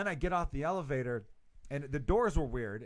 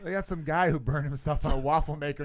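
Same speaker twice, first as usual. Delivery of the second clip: ordinary talk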